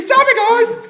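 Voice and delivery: high, loud puppet-frog squawk; Kermit the Frog. Retold or imitated. Mocking a friend's impression, squeaky loud Kermit. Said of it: "He's like".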